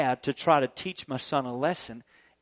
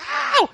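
put-on sharp, much higher cry of a stung kid; child's yelp of pain; 3 dB louder than usual. Stung son crying out to his dad, sharp high yelp. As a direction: yelp like a boy just stung. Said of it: "he goes like".

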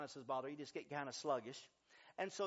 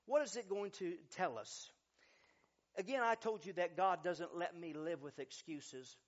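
ordinary talk, nothing marked

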